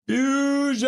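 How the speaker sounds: high, loud shout; shouted catchphrase. Real, not acted. Echoing a viewer's catchphrase with a high shout. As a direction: yell it out.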